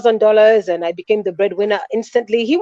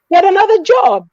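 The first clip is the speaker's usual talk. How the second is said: pleading shout; 10 semitones higher, 5 dB louder than usual. Retold or imitated. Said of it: "I said".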